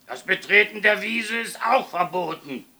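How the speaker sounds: high, very loud prohibition; stern shout. A narrator's reading. Caretaker forbids children the meadow, sternly shouting. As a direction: thunder the rule at them.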